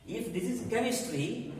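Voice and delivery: ordinary talk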